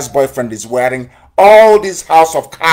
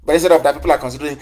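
ordinary talk

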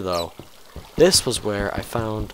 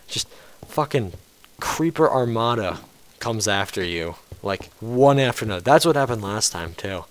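ordinary delivery

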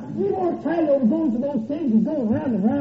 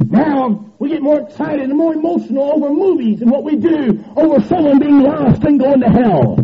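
preaching at full force; 3 semitones higher, 6 dB louder than usual. Real, not acted. Preacher rebuking church's misplaced excitement, preaching at full force. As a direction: hammer the rebuke home with rising force.

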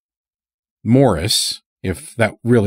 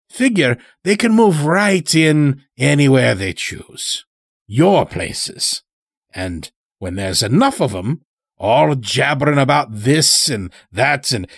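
put-on a higher, odd country voice for the old stranger; sly old-timer with an unplaceable accent; Morris's strange accent; 6 semitones higher than usual. A narrator's reading. Chained old stranger talking to the lodge master, in an odd accent. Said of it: "spoke in such a strange way".

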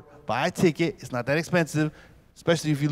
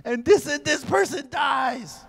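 mock shout; 10 semitones higher, slower than usual. Spoiler guy yelling ending.

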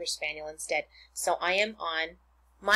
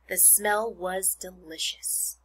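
usual speaking voice

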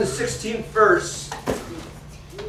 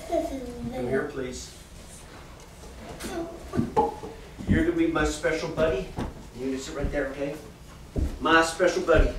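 gentle coaxing; 7 semitones lower, 9 dB quieter than usual. Real, not acted. Preacher calling the boy Jaden to the front, soft and tender. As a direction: softly invite the little one up.

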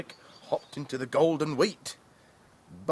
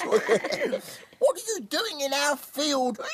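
high slow laughing piglet voice; grunting piglets; 12 semitones higher, slower than usual. Acted. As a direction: grunt it like little pigs. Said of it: "Grunted the three piglets".

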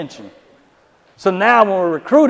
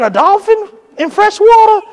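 much higher, louder incredulous question; doubtful girlfriend; 14 semitones higher, 5 dB louder than usual. Retold or imitated. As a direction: ask it in disbelief.

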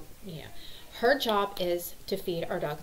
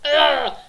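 loud, higher "ugh"; exasperated groan; 8 semitones higher, 14 dB louder than usual. Retold or imitated. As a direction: let out a big frustrated groan. Mom's groan at screaming kids.

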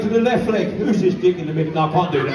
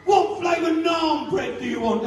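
waiter shouting; 7 semitones higher than usual. Retold or imitated. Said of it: "And they say".

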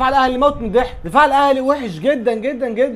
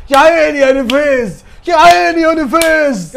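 drawn-out admiration; 3 semitones higher, 6 dB louder, slower than usual. Real, not acted. Praising Neves, slow drawn out.

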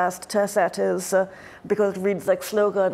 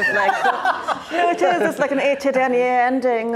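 laughing; 5 semitones higher, 4 dB louder than usual. Real, not acted. Laughing at own reading.

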